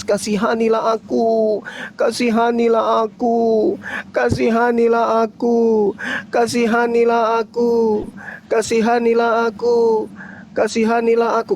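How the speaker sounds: pleading wail on loop; skipping cd. Retold or imitated. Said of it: "Kasetnya rusak". (seperti kaset rusak, memohon berulang-ulang)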